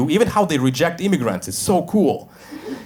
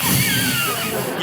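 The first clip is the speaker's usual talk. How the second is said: high hammed-up evil laugh; villain laugh. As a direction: cackle like a caught vampire. Caught drinking blood.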